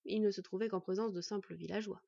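ordinary delivery

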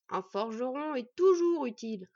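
slow, loud, haughty retort. Acted. Smith answering his wife's mockery, haughtily. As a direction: puff it up with self-importance. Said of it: "rétorqua Lodberg avec hauteur".

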